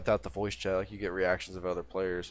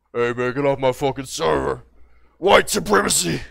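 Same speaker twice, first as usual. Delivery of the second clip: louder, higher angry ranting edgelord; mock server host; 4 semitones higher, 11 dB louder than usual. Server host yelling at a newcomer, louder mock rant. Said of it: "people who just sound like".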